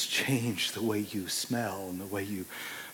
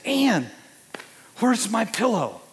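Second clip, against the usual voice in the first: high, loud call across the house; 9 semitones higher, 5 dB louder, slower than usual. Retold or imitated. Husband calls to his wife about the missing pillow, loud and high. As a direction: call up to your wife, puzzled. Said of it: "And I said".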